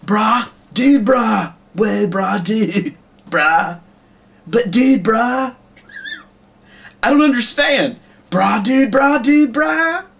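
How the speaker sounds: very high, exaggerated dude-speak; mocking bro voice. Shown as a demonstration. Puppeteer making fun of bro talk, hammed-up high voice. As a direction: send up a clueless bro in a squeezed high pitch. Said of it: "Making fun of people who speak like that".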